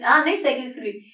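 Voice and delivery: ordinary talk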